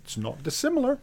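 high-pitched, mock-innocent reply; retold coy reply. Artist replying to screaming fans, high coy retold admission. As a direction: answer in a small, high voice.

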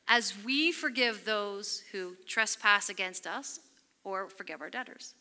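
slow, measured line for people to join; slow congregational recitation; leading a prayer. Shown as a demonstration. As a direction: pace it so the room can follow. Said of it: "Say this with me".

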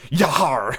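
hammed-up pirate yar. Shown as a demonstration. Reader trying out a pirate voice, hammed-up. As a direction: growl it like a stage pirate. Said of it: "for this book, it's gonna be".

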